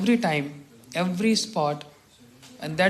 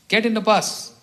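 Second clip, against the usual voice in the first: firm raised-voice command to get on the bus; stern order; 5 semitones higher, 6 dB louder than usual. Retold or imitated. Elder brother ordering the tearful junior back onto the bus, loud and firm. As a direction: bark it out like an elder brother laying down the law.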